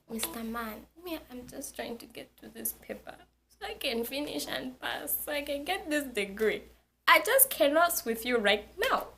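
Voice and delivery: soft start climbing into exasperation; weary pleading. Retold or imitated. Student begging bus driver for peace, weary pleading. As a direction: plead under your breath, pitch rising at the end.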